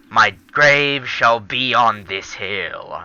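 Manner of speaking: slow, hammed-up epic declaration; mock-grand proclamation. Player choosing a grave in the game, mock-grand proclamation. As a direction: proclaim it slowly like mock last words.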